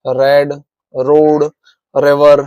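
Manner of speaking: रेड, रोड, रिवर each begun with a clear र sound. Shown as a demonstration. Teacher giving examples of the R sound, र clearly sounded in रेड, रोड, रिवर. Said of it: "र बोला जाता है".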